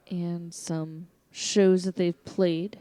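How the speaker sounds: normal talking voice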